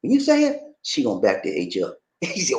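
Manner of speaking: ordinary talk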